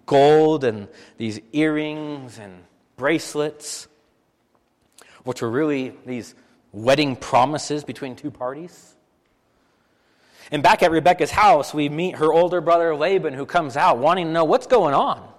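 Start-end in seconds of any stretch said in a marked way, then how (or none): none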